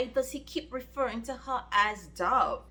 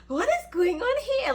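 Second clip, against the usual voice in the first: high, loud disbelief at the plot; baffled outcry; 12 semitones higher, 4 dB louder than usual. Real, not acted. Reader shocked by the book's twist, high exclaim. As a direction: throw up your hands in shock.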